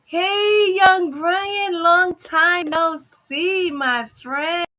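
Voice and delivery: high, loud, drawn-out hello; excited greeting. Real, not acted. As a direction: greet him with a big happy lift. Greeting a viewer by name.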